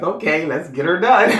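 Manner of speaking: playful mock-agreement, voice lifted; amused mock echo. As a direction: echo the catchphrase with a chuckle ready to burst. Mockingly echoing coworkers' catchphrase.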